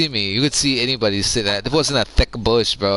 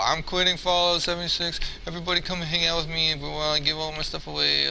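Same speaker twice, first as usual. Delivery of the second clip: higher, softer mocking imitation; put-on, high-pitched quitter voice; mock farewell announcement; 6 semitones higher, 4 dB quieter than usual. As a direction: imitate an attention seeker's big goodbye. Mocking an attention-seeking gamer's farewell.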